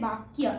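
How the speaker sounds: বাক্যা as "bak-ya", y sounded; Sanskrit pronunciation. Shown as a demonstration. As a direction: say বাক্যা with a clear y after k. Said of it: "তাহলে এখানে উচ্চারণ হবে".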